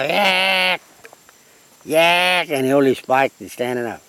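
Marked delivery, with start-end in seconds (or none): 0.0-2.5 long, raised single squawks. Retold or imitated. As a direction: hold each cockatoo squawk, higher than speech. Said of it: "He go".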